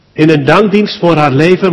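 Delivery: ordinary delivery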